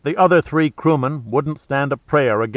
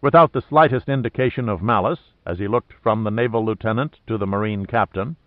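ordinary delivery, nothing marked

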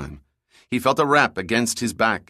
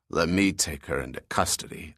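low, softer gruff bark; barking brute; 5 semitones lower, 5 dB quieter than usual. Acted. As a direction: growl it like a thug. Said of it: "the shipboard brute barked".

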